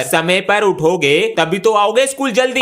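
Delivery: normal talking voice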